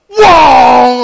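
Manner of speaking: a loud, high yell of speed; shouted whoa. Retold or imitated. Telling how his Subaru took off, loud high whoa. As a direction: belt it out like a car launching. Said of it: "my car was driving just went".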